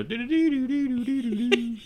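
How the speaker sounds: high, twangy banjo plucking sung out; banjo imitation. Teasing her country accent with banjo plucking. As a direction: plink it like a banjo. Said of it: "you hear the banjo".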